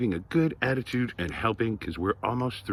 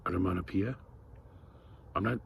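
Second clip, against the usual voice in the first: ordinary delivery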